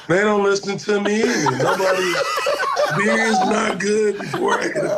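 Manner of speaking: laughing while talking; cracking up mid-sentence. Real, not acted. Both laughing at early call.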